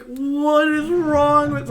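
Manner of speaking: reacting to the old lady's reacts; incredulous. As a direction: drag out the disbelief. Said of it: "I literally was like".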